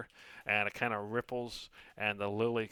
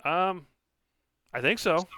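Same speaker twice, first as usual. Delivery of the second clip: high, loud tiny voice; unsure little voice; 7 semitones higher, 9 dB louder than usual. Acted. Lily spirit voice.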